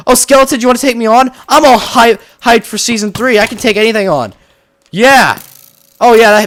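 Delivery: hyped taunt. Real, not acted. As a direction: pump yourself up and dare the skeleton. Taunting a Minecraft skeleton.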